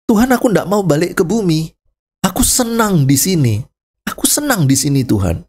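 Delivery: high, pleading protest; lightly whiny complaint; complaining soul. Retold or imitated. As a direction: beg to stay in heaven. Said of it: "itu komplain".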